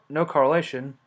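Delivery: ordinary talk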